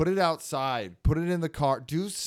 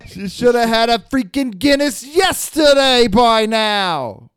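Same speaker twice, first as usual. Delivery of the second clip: slow, high East Coast shout; hammed-up East Coast bellowing, stretched out; East Coast Irish-Italian; 10 semitones higher, 13 dB louder, slower than usual. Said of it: "We're not good Irish Italians".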